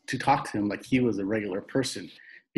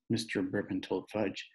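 plain reading voice

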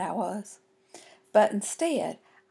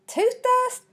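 high, incredulous half-shout; kinda shouted. A narrator's reading. Girl echoing her dad about tooth dust, kinda shouting. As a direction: shout it up high like a startled kid. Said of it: "I kinda shouted".

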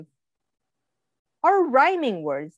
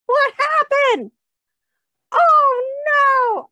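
high, loud hammed-up surprise; mock alarm; 14 semitones higher, 7 dB louder, slower than usual. Reader to children, loud high mock dismay. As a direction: play up the shock for the kids. Fish splashed out.

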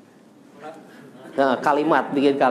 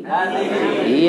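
hadhihi drawn out slowly, syllable by syllable; slow model word; much slower than usual. Shown as a demonstration. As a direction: stretch out hadhihi for the class. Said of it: "Hada atau hadhihi?".

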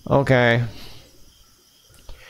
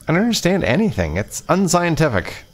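fast, high, bewildered babble; flustered panic; 7 semitones higher than usual. Acted.

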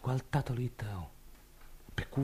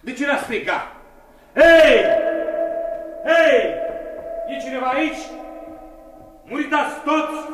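high, loud repeated calling; defiant yelling for anyone; shouting; 13 semitones higher, 9 dB louder than usual. Acted. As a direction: call out into the empty inn, louder still. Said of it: "nu striga".

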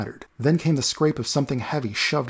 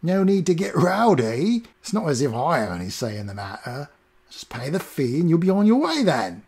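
squirming cockney doorman; 4 semitones higher than usual. Acted. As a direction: placate him like a cornered doorman.

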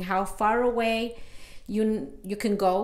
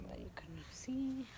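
whispering; 4 semitones higher, 18 dB quieter than usual. Real, not acted. Quiet aside while testing mic.

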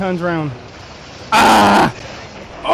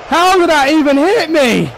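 stunned outcry; 7 semitones higher, 3 dB louder than usual. Real, not acted. Gamer hit by surprise attack.

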